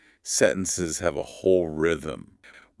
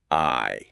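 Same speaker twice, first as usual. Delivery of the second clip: "I" held long on its own; stressed I; 5 semitones lower than usual. Shown as a demonstration. Teacher modelling the first word, long and stressed. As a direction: draw out the word "I". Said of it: "make it louder and longer on the very first word".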